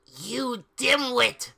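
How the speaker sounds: slow, high mock-angry jab; angry insult. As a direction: spit the insult out slowly. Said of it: "began to shoot angrily".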